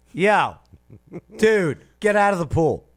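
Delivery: loud high shout; calling out. Retold or imitated. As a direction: yell it across the pool. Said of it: "You have to be brave now to say".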